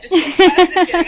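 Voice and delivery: loud laughing just kidding; laughing loudly. Real, not acted. Teasing her friend about a big head, laughing loudly. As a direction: blurt it out through a big laugh.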